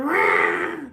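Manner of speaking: imitating a cat's low, loud howl; mock cat wail, low; low cat yowl. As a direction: mimic a yowling cat, deep and loud. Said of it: "She's like".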